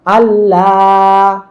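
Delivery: আল্লাহ with a long held লা; short আল, then stretch the লা slowly; আল্লাহ, last vowel drawn out on a level note; long madd. Shown as a demonstration. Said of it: "এই জন্য টান দিচ্ছি".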